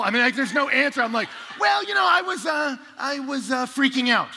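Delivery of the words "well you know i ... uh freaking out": loud, high, halting excuse; sheepish confession. Retold or imitated. Bloodied hiker explaining himself to the couple, sheepish. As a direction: stall in a high voice, then admit it.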